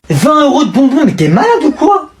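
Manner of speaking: high indignant outburst; big cousin shocked at candy spending, high; outraged disbelief. Acted. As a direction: scoff in disbelief.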